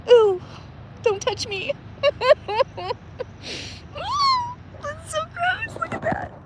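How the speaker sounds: high squeals of real disgust as debris drifts past; grossed-out squeal. Real, not acted. Vlogger squeals at the river debris, high and grossed out. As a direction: shriek it like something slimy just brushed you.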